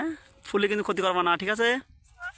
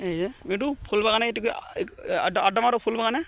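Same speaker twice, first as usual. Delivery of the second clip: pet talk; 2 semitones higher than usual. Real, not acted. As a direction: sweet-talk the parrot into a kiss. Coaxing parrot in flower garden.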